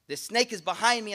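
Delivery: ordinary talk, nothing marked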